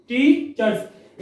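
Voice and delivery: "Teachers" drawn out very slowly, ending in z; slow demo. Shown as a demonstration. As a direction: stretch "teachers" syllable by syllable. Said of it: "तो इसको पढ़ेंगे teachers". ("Teachers" बहुत धीरे बोलकर दिखाना)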